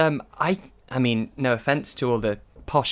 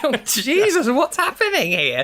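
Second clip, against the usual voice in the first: high-pitched, half-laughing alarm; mock shock; 10 semitones higher than usual. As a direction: play the appalled viewer, voice shooting up. Acting out a shocked viewer.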